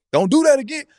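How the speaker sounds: high, playful scold; mock scolding. Player mock-scolding a teammate after a steal, high voice. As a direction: pretend to tell him off.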